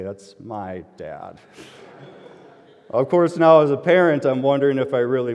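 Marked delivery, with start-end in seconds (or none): 0.0-1.4 embarrassed admission. Retold or imitated. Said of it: "my oldest daughter had to say".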